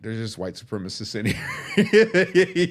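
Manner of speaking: slow, laughing exclaim; laughing alarm. Real, not acted. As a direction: laugh in disbelief.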